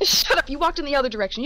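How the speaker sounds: loud, high and rushed mock yell; playful fast shout. Real, not acted. Telling a player their character walked away, in a fast playful shout. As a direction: shoo them off fast and loud, half laughing. Player jokingly scolds absent player.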